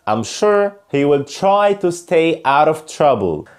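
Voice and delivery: slow teaching read, "travel" and "train" with "chr"; slow, careful model with "try" as "chry", "trouble" as "chrubble"; American TR model sentences. Shown as a demonstration. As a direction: read slowly, making "country" and "traditions" carry a "chr" sound. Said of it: "تعالوا بقى ناخد كده بعض الأمثلة على بعض الجمل".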